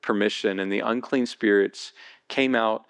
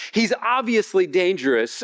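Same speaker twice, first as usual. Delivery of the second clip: usual speaking voice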